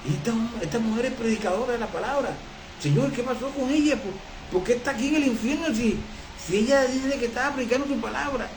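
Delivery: puzzled question. Retold or imitated. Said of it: "Y yo digo".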